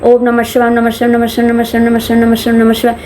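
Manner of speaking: fast, run-together monotone chanting; rapid mantra repetition. Shown as a demonstration. Teacher showing how beginners practise the mantra, rapid repeated chanting. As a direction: rattle the mantra off over and over without pausing. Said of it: "पहले तो हम बहुत जोर से बोल रहे होते हैं".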